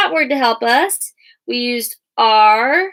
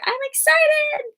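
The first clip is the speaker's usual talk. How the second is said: much higher, slower, thrilled; excited cheer. Shown as a demonstration. Teacher acting out what an exclamation point says, excited. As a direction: throw your arms up and squeal it. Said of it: "throw your arm up in the air".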